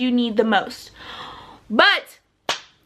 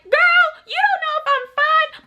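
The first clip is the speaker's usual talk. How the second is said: sassy retort; 14 semitones higher than usual. Retold or imitated. To the classmate saying it's fine, high sassy retort. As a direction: snap back at her with attitude. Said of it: "I was like".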